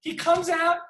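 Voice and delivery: normal talking voice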